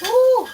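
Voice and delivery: sharp high squeal; high yelp. Real, not acted. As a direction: squeal at the burn. Spicy shot reaction.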